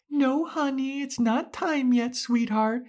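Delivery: very high, sugary talking-down voice; mock patronizing Anya. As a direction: coo at her like a small child.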